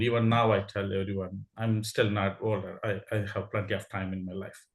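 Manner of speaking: ordinary talk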